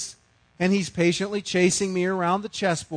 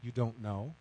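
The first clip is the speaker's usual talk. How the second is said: wife's quiet reply; 7 semitones lower, 12 dB quieter than usual. Retold or imitated. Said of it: "and she says".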